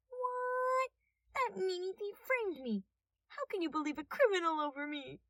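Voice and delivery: high, fake-teary whine; detective fake-crying innocence; whining. A narrator's reading. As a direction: whine like a wronged child. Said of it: "the detective whined".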